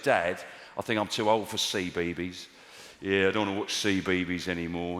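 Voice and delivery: put-on low-pitched comic take on a child; mock daughter in a low, incongruous voice; wrong-voiced little daughter. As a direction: voice a six-year-old far too low and grown-up. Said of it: "she doesn't talk like that".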